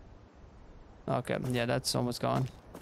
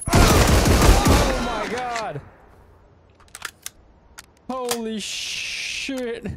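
terrified yell; 9 semitones higher, 10 dB louder, much slower than usual. Real, not acted. Frightened by enemy.

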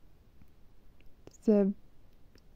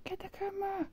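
high excited call; 11 semitones higher, 9 dB quieter than usual. Real, not acted. Calling out high.

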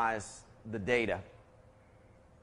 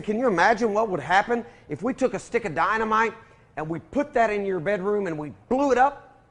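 excited, animated; 7 semitones higher, 6 dB louder than usual. Retold or imitated. Dad voicing himself to son.

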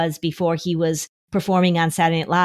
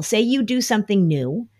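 ordinary talk, nothing marked

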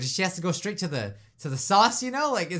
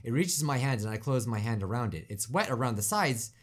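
low, measured scene-reading; subdued first-person storytelling; story narration; 5 semitones lower, 4 dB quieter than usual. A narrator's reading. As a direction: narrate quietly and evenly, pitch sinking. Reads game narration aloud.

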